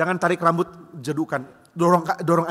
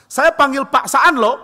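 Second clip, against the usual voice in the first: high, loud and slow threat; threatening teacher; 9 semitones higher, 11 dB louder, slower than usual. Retold or imitated. Teacher threatening pupils with the strict teacher, high and loud. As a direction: warn them slowly and shrilly that you'll fetch the head. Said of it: "guru pun ancamannya sama".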